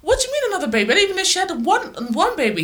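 high, disbelieving complaint; incredulous teen. Retold or imitated. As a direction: protest like a baffled teenager. Retold teen outburst at dad.

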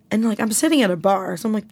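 ordinary talk

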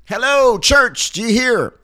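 high ringing call from a rooftop; rooftop alarm call. Pretend. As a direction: shout it out like a watchman. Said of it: "just picture me right now on the rooftop".

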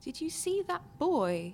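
put-on child's voice. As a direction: ask it wide-eyed like a kid. Child in cabin asks.

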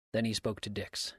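plain reading voice

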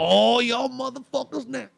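high, slow, drawn-out cursing; angry grumbling. Retold or imitated. Uncle woken by the noise cursing everyone, angry. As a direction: imitate an irate uncle cursing from afar. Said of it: "he's already talking shit before he even comes".

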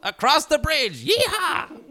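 high, loud, drawn-out hillbilly-style whoop; hammed-up rowdy heckler; mock whooping. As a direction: holler it slowly and gleefully. Playing whooping local hecklers.

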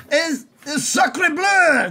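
high, slow, shouted French exclamation; French chef. Acted. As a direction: blurt it out like a shocked French chef. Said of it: "Just, like, freaking out".

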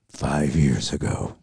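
drawn-out low trailer announcer, cracking up; movie trailer voice. Pretend. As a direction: boom it like a movie teaser. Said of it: "the movie trailer voice".

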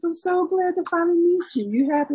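imitating him in a high put-on voice; high mock suitor voice. Retelling the suitor's eager greeting in a high mock voice. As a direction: lightly send up his eager greeting, pitched high. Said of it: "he's like".

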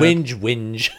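mock whingeing, punched out; exaggerated complaining chant; Badge. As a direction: grumble in a comic impression. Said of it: "make the best impression of Badge".

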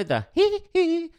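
high falsetto squeal. Retold or imitated. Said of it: "that sort of thing".

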